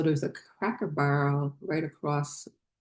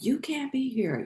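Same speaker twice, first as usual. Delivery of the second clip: imitated woman's high-pitched objection; retold scolding; 9 semitones higher than usual. Hotel employee ordering a car sleeper away, mock scolding. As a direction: pitch it well up, mimicking her telling-off. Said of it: "she's like".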